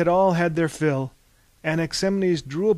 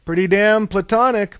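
belched; 6 semitones higher, 7 dB louder than usual. Acted. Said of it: "belched Prometheus".

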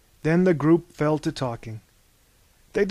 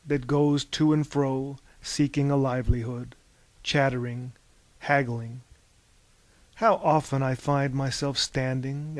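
gentle recital of a poem; poem reading; 4 dB quieter than usual. Acted. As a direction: read it wistfully aloud. Anaximenes reads a poem.

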